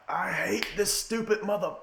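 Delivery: put-on a kid torn between hate and hype; kid's mock outrage. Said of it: "I was like".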